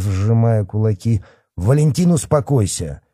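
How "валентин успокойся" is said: raised-pitch stern order; harsh command. A narrator's reading. Boss calms the raging policeman, harsh command. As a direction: rein him in hard. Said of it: "жёстко сказал ему Михайлов".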